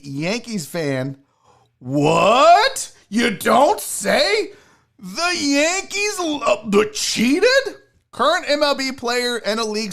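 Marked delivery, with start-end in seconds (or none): 1.8-7.8 slow, drawn-out fake gasping disbelief; mock-crying astonishment, very slow; mock shock. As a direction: ham up the surprise, stretching every word. Mock shock at Yankees cheating.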